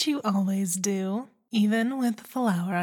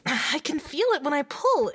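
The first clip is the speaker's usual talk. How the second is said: panicked; 7 semitones higher than usual. Acted. Panicking about stuck toy.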